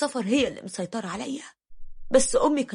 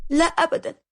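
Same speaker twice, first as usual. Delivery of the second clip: a touch of nervous denial, pitched up; mildly flustered wife, higher voice; 7 semitones higher, 4 dB louder than usual. A narrator's reading. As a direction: deny it quickly, slightly tense. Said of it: "قالت لي بتوتر".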